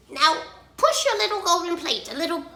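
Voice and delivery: high, loud frog voice; demanding frog. A narrator's reading. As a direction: order it as a pushy little frog.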